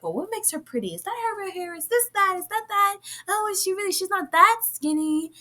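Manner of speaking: high-pitched, imitated catty onlooker; catty scrutiny. Voicing critics sizing up her looks.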